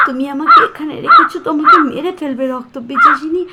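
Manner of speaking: loud, fast, desperate warning; frightened threat. Acted. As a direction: shout the threat at the vampire. Captive threatens the vampire. (ভয়ে চিৎকার করে হুমকি)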